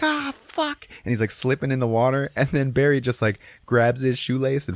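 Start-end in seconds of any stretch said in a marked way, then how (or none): 0.0-0.9 high-pitched mock yelp; retold pained yelp. Stoner burned by boiling water, yelping. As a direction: cry out high as if scalded.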